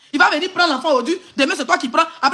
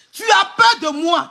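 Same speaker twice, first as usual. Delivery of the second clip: taunting shout; 2 semitones higher, slower than usual. Real, not acted. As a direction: drag out each word like a dare. Taunting rival on live stream.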